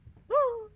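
sharp high frightened gasp; loud shocked inhale; startled gasp. Real, not acted. Startled by sound.

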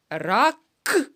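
"Рак-к-кета" with the к repeated and stressed; stretched k sound. Shown as a demonstration. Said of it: "Повторили".

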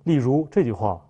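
ordinary delivery

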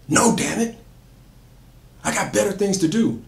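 put-on high, loud angry snap at the kid; angry yell. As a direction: bark it at him, fed up. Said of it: "then she yells at him".

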